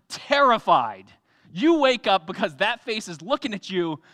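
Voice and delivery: usual speaking voice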